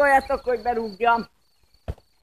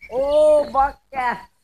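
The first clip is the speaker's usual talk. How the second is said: frustrated shout; 2 semitones higher, 8 dB louder, much slower than usual. Real, not acted. Ball hits the post.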